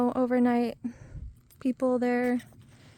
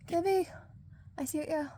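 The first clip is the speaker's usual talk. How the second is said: affectionate; 7 semitones higher, 8 dB quieter than usual. Real, not acted. Greets her RV affectionately.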